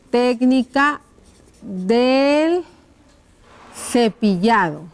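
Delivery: slow, each word set apart; writing-out dictation. Shown as a demonstration. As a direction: dictate word by word as you write. Dictates while writing the question out. (dictado lento, palabra por palabra)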